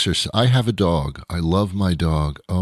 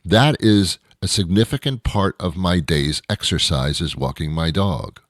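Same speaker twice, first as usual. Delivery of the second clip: ordinary delivery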